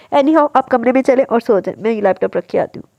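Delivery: usual speaking voice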